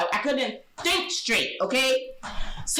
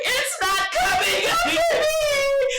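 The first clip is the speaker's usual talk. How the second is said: high, slow, laughing whine; stressed whine; much slower than usual. Retold or imitated. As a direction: wail it in frustration. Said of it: "I'm like".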